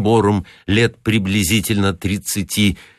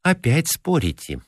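reproachful; 7 semitones higher, 3 dB quieter than usual. A narrator's reading. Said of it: "укорила дежурная".

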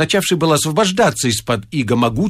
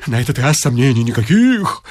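excited; 7 semitones higher than usual. A narrator's reading. Ecstatic recognition of chairs.

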